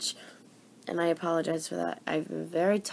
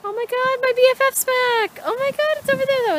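excited squeal; 12 dB louder than usual. Real, not acted. Birds return, she squeals.

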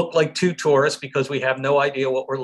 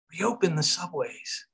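slow, softer and higher; hushed echo; 4 semitones higher, 5 dB quieter than usual. Real, not acted. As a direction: echo it softly and slowly, taking it in. Awed echo of the mayor's announcement.

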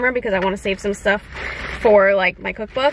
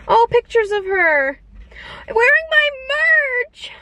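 high, stretched squeal, rising higher; delighted squeal; 12 semitones higher than usual. Real, not acted. Vlogger finds photos of a fan in her merch, delighted squeal. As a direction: squeal in touched delight, building to the end.

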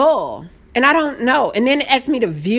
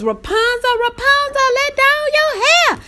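fairy-tale call; 13 semitones higher than usual. Acted. Telling viewers the name is Rapunzel, high storybook call. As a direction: sing it out high like calling to a tower.

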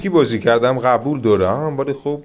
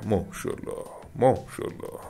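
hushed, breathless mashallah; awed whisper; 9 dB quieter than usual. Real, not acted. A gamer whispers to viewers in awe at the damage. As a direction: whisper it in awe of the big number.

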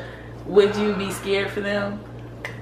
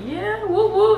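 cheering; 10 semitones higher, slower than usual. Real, not acted. Cheering her own major.

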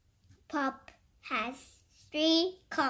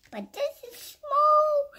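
tiny high voice; 13 semitones higher, 6 dB louder than usual. Real, not acted. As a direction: voice leaps high and loud for smallness. Contrasting small babies with big mommy.

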